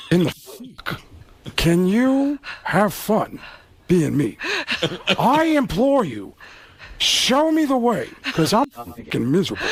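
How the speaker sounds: an anguished Pacino with slow pleading; a miserable star begging for answers; Al Pacino impression. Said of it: "and Al Pacino".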